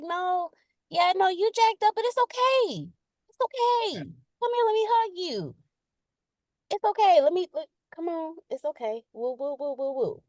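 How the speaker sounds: high, cooing reassurance; sugary comforting. Acted. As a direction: coo sweetly as if pulling someone into a hug. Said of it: "I'm the sugary person".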